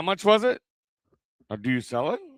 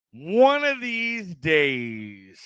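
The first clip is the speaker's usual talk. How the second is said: ONE OF THESE DAYS, stretched very slowly; very slow, elongated musing; drawn-out repeat; much slower than usual. Real, not acted. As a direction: draw out every word, lingering on DAYS.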